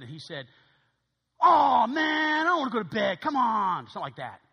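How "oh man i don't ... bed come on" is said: higher and louder, complaining whine; whining son. Retold or imitated. Mo complains to his mother about bedtime, loud whine.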